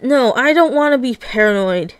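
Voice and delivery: ordinary delivery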